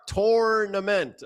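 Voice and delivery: "Tournament" said slowly, the R clearly sounded. Shown as a demonstration. Host showing his co-host the R, slow and clear. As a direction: draw out "Tournament" so every R is heard. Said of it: "Are you not hearing the R?".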